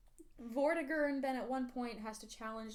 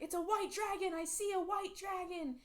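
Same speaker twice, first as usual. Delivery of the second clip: visionary; 8 semitones higher than usual. Retold or imitated. Merlin's vision, acted quote.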